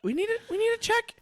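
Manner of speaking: high, quick granny voice; granny pushing her grandson; foul mouthed granny. Acted. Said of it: "my grandma voice is not good".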